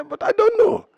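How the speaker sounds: pitched high, baffled; high puzzled protest. Retold or imitated. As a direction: squeak it out innocently. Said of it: "I say".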